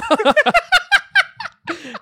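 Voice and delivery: squealing high laugh breaking out; high burst of laughter. Real, not acted. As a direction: let a shrieking laugh burst out. Cracks up at his own story.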